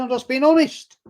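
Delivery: high, insistent protest; retelling the roast row, insisting he is honest; mock indignant. Retold argument, high indignant.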